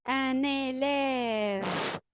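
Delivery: very slow, every sound stretched out; drawn-out model word; slow teaching voice. Shown as a demonstration. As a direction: spell it out by ear for the class. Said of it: "teacher models a word to repeat".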